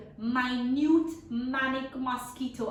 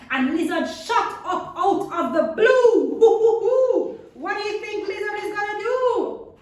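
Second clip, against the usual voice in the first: much higher and louder, with a laugh; big exciting reveal for the kids; dramatic burst; 10 semitones higher, 12 dB louder than usual. A narrator's reading. Lizard bursts out of sky.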